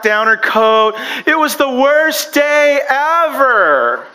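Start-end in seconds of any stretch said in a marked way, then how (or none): 1.2-4.0 mock sulky child. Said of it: "She goes".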